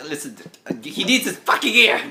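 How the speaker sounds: acted scolding, not a full scream; mock screaming argument. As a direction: insist on it like a fed-up cook. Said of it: "She's screaming at the wizard".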